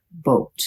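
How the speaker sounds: "Boat" with a long oh vowel; hard O. Shown as a demonstration. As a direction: round "Boat" into a clear oh. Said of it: "sounds like hard O".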